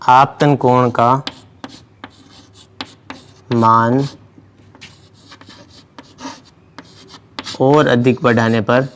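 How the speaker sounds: word by word, long pauses; slow dictation. Real, not acted. Teacher dictating a physics note to students, slow dictation. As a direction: dictate slowly while writing it out. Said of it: "इसमें हम लिखेंगे".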